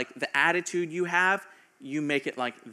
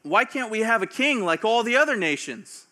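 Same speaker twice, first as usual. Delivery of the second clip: higher, louder mock demand; demanding Israelites; 7 semitones higher, 7 dB louder than usual. Israelites asking Samuel for a king, mock demanding. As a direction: push the question like a jealous crowd. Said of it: "they said".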